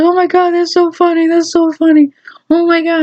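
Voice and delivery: high, gushing imitation of mom; mom in hysterics. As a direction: act out a mom overcome with amusement. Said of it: "My mom started about, she goes".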